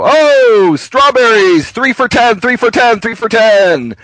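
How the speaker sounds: high, loud singsong vendor patter; market vendor. Retold or imitated. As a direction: hawk strawberries and watermelon to passers-by. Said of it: "There they'll tell you".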